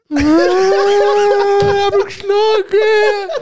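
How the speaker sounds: put-on high, drawn-out bawling child; exaggerated crybaby wail, words stretched very slowly; mock wailing. Little brother telling dad he was hit, hammed-up wailing. Imitates crying brother tattling to father.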